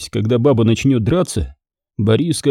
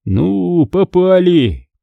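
dismayed groan; 7 semitones higher, much slower than usual. Acted. Boriska sighs at trouble.

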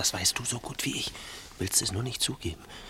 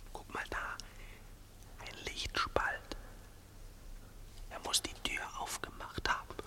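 hushed, fearful whisper; tense whisper; 10 dB quieter than usual. Acted. Creeping up to the lit door, whispering. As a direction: barely breathe the words.

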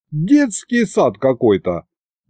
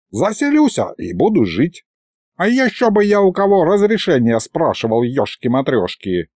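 put-on higher-pitched grumpy old wizard; stubborn old sorcerer; 4 semitones higher than usual. Old sorcerer insists on moving in, stubbornly. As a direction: dig in like a cranky old mage.

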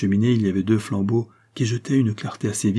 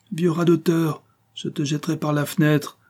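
blustering; 7 semitones higher than usual. A narrator's reading. Impostor blusters at old servant.

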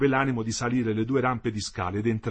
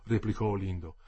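ordinary delivery

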